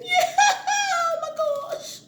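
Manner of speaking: high laughing wail; stretched tearful laugh; laugh-crying squeal. Real, not acted. Laughing through onion tears.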